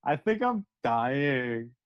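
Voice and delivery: slow, low, blissed-out drawl; blissfully happy. Retold or imitated. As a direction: imitate being ecstatic in a dazed, drawn-out way. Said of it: "Why are you so happy about it?".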